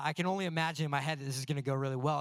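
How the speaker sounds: ordinary delivery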